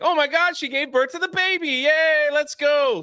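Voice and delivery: high, gushing mock excitement; excited crowd. Crowd celebrating the baby's birth, high mock gushing. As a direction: squeal like thrilled fans. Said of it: "And they're like".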